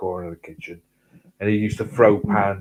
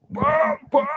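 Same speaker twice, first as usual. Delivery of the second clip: punch noises. Retold or imitated. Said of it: "he's gone".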